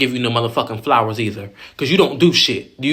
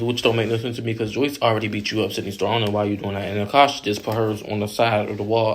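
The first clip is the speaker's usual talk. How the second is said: normal talking voice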